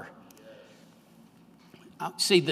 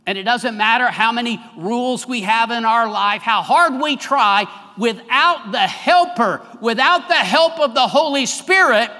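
rising to a louder, higher preaching cry; building preaching; 4 semitones higher, 5 dB louder than usual. Acted. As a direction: build the sermon line up to its peak.

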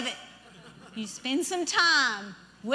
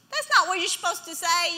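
ordinary talk

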